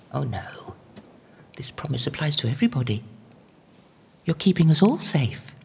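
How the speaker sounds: intimate murmured prayer, often whispered; private evening prayer. Acted. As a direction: talk to God alone at dusk. Evening prayer spoken alone.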